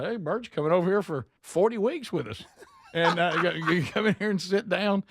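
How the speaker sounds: high, laughing imitation of a foolish thought; laughing self-mockery. Man retells his mistaken belief about the speaker, high and laughing. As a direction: laugh through it, mocking yourself. Retold guy mocking his own idea.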